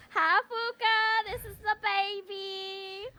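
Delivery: syrupy mock impression; imitating a friend. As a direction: stretch the greeting in a teasing put-on voice. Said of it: "You sound like that in my brain".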